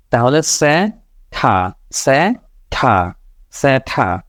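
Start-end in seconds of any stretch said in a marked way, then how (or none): 1.3-4.2 সে and ঠা said apart, very slowly; syllable drill. Shown as a demonstration. Teacher sounds out syllables very slowly. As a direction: break it into সে ঠা. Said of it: "ছোট আ উচ্চারণ করতেছে".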